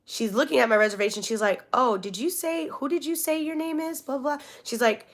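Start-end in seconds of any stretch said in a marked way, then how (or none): 1.7-4.4 high, soft questioning clerk voice; puzzled clerk. Retold or imitated. Rental lady checks the reservation, mock puzzled voice. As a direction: imitate a clerk querying politely.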